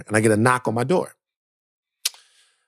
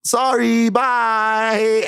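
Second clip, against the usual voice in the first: higher, light fake-polite sorry bye; quick bright brush-off; 7 semitones higher, much slower than usual. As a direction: chirp it out and shut the door. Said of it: "And I was like".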